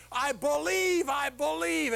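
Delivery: high, loud earnest answer; eager pleading. Acted. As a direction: swear it desperately. Desperate man answers God.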